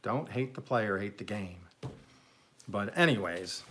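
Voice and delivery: ordinary delivery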